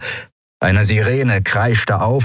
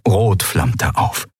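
plain reading voice

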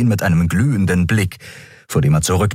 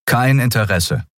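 firm voice; 4 semitones higher than usual. Acted. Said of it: "sagte Kai mit fester Stimme".

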